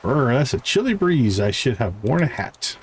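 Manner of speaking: ordinary talk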